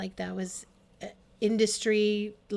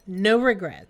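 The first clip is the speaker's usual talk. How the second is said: "No regrets" repeated much louder and firmer; emphatic louder echo; 10 dB louder, slower than usual. Real, not acted. Friend echoing her own words, loud and firm. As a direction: belt the echo out with conviction.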